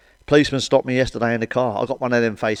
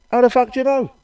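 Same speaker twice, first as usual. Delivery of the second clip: mock indignant; 13 semitones higher, 5 dB louder than usual. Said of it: "I went".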